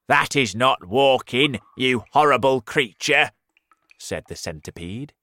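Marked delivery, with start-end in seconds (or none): 0.1-3.3 insulting. Acted. Centipede insults earthworm.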